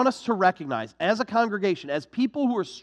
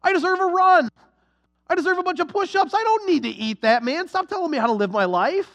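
defiant retort; 8 semitones higher than usual. Pretend. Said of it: "It's like".

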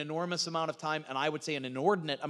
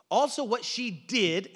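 "did" pushed loud and drawn out; slow down and hit "did" hard; stress on DID; 5 semitones higher, 5 dB louder, slower than usual. Shown as a demonstration. Preacher quoting Jesus on her deed, stressing "did". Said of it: "emphasis on that verb to do".

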